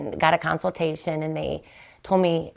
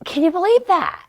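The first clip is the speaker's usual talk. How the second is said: excited; 12 semitones higher than usual. Real, not acted. Excited disbelief at mystery gift.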